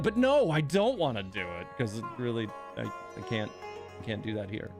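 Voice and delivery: ordinary delivery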